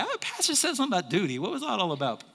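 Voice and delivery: put-on high, swooping child's voice; mock kid's question, starting high and falling; imitating a kid. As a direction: pipe up like a puzzled kid in church. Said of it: "your kids are in here like".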